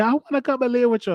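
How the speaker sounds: put-on high, quick little-kid plea; lightly voiced small niece begging. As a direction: imitate an eager small child. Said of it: "But she said".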